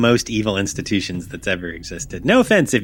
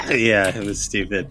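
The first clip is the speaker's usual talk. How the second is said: laughing; 2 semitones lower than usual. Real, not acted. Still laughing after the joke.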